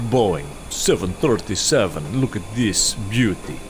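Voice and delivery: hammed-up Russian accent. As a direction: play a proud Russian pilot with a thick accent. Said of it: "Got the Russian at least".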